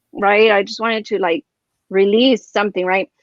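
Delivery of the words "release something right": usual speaking voice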